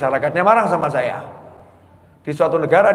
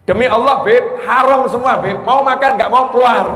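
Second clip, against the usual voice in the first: louder, higher shouting, slowed on the curse; angry shout; 7 semitones higher, 5 dB louder than usual. Retold or imitated. Host yelling at the cleric who insulted his food, furious shout. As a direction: yell back at the guest in fury. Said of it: "Akhirnya teriak".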